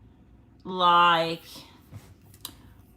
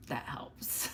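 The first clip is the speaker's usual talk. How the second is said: whispered aside, much softer; 6 semitones lower, 13 dB quieter than usual. Real, not acted. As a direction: breathe it out as a secret tip. Repeats phrase as whisper.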